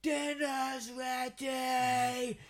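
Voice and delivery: long drawn-out call from the doorstep; calling kids to dinner. Retold or imitated. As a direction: shout it out high and stretched. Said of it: "our mum would lean out the front door and go".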